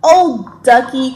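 loud storybook exclamation; crying out. A narrator's reading. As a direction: call out the name as a story character. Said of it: "cried James".

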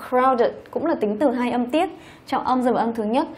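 ordinary talk

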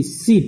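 short crisp "sip" with a clear s; sip sound. Shown as a demonstration. Teacher modelling "sip" for students. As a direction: say "sip" slowly and distinctly. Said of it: "and this one is S H".

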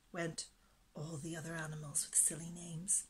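hushed whispered narration. A narrator's reading. Narrator telling children the story, whispered aside. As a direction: breathe the words almost silently.

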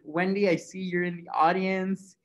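normal talking voice

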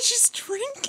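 high pitched laughing disbelief; giddy shocked squeal. Real, not acted. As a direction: squeal at the surprise. Shocked the nun drinks.

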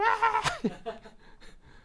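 high amused laugh. Real, not acted. Pastor laughing as the child screams, high. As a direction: laugh brightly.

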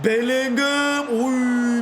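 long, drawn-out high call of the name; excited shout. Real, not acted. Gamer calling out his striker's shot, excited. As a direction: stretch the name as the shot flies.

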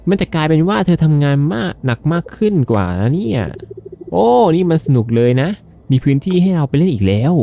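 high-pitched, animated fish dialogue; talking fish character. Acted. Gamer reading out the fish's dialogue, high character voice.

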